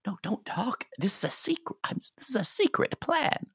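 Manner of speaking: conspiratorial hushed high voice; whispering. Acted. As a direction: let them in on a secret, hushed. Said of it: "Why are we whispering?".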